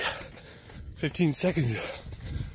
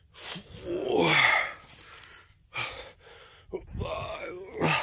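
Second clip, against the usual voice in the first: counting through the strain; 5 dB quieter, much slower than usual. Real, not acted. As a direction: gasp out each count.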